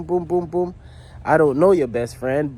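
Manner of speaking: ordinary talk, nothing marked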